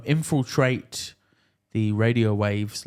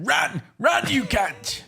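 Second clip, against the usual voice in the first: high, drawn-out yell; hammed-up high-pitched shout; mock shout; 12 semitones higher, much slower than usual. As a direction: yell it like an overexcited police-show commentator. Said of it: "Just shout".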